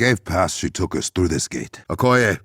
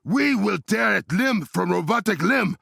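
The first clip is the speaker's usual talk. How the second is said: battle shout; 13 semitones higher than usual. Acted. Battle cry against robot.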